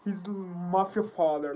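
usual speaking voice

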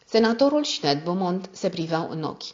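ordinary delivery

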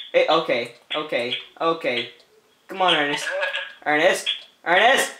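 normal talking voice

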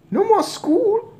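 very high, slow, loud squeak; gleeful mouse boy. A narrator's reading. Mouse boy rejoices over school, squeaking gleefully. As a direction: squeak it with delight.

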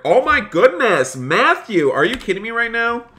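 high, loud, thrilled disbelief; astonished outburst. Real, not acted. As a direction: burst out at an unexpected gift. Stunned by another donation.

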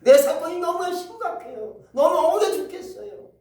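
high, loud desperate pleading; pleading shout. Acted. Widow shouting to the unjust judge, pleading shout. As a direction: wail your grievance at the judge. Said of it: "날마다 와서".

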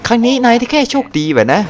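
เยาะเย้ยแบบคู่แข่ง เสียงสูงขึ้นมาก; แกล้งทำเสียงคู่แข่งที่ยั่วยุ; mock rival taunt. (พากย์คำเย้ยของคู่แข่งด้วยเสียงสูง)

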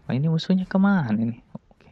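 ordinary talk, nothing marked

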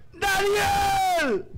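high, loud anguished wail of the name; king's wailing cry. Acted. King Darío at dawn calls for Daniel, wailing loudly. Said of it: "el llanto del rey Darío fue este".

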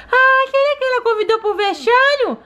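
put-on high childlike voice of a small daughter; little girl voice. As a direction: play the sick little girl talking to mom. Doll interrupts story.

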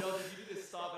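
bouncer's warning retold with laughter; laughing through quote. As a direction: laugh while telling the bouncer to stop.

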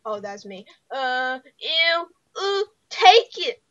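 ordinary talk, nothing marked

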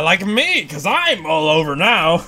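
normal talking voice

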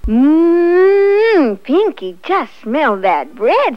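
high, loud, drawn-out mmm; delighted relish. A narrator's reading. Sassy the cat tells Pinky to smell the bread, relishing. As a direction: savour it long and loud.

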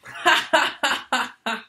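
high, loud burst of laughter. Acted. Lodger laughs at having misjudged the deaf landlady, loud laugh. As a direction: laugh out loud at your own foolishness. Laughs at his own mistake.

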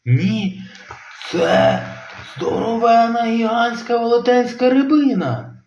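high, sing-song excited; excited insisting. Acted. Robin insisting to dad, high excited sing-song. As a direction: build it up, huge fish.

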